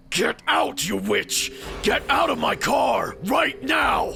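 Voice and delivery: high, loud furious scream. A narrator's reading. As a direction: scream her out of the car. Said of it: "He then screamed at me".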